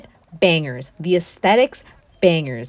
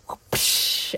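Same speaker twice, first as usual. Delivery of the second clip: mock push sound; 6 dB quieter than usual. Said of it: "And she goes".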